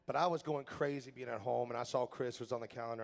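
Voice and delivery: ordinary delivery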